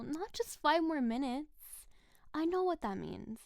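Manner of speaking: ordinary talk, nothing marked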